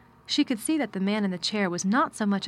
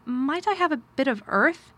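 put-on higher, rising child's plea; eager; 6 semitones higher, 4 dB louder than usual. A narrator's reading. Girl begging her guardian for a favour, shyly eager. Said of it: "In her eagerness".

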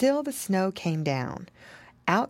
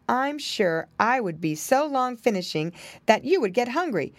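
ordinary delivery, nothing marked

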